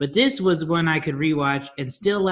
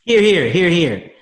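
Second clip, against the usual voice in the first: hear hear cheer; 4 semitones higher, 8 dB louder than usual. Acted. Cheering agreement on podcast.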